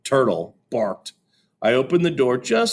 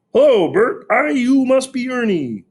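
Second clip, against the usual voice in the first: much higher, louder Muppet voice; high puppet voice; 6 dB louder than usual. A narrator's reading. Fudge greeting his father as a puppet, high voice. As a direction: chirp it like a puppet.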